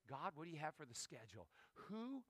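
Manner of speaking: quiet morning prayer asking God for the day, soft. Retold or imitated. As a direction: pray it softly to God. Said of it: "my day is".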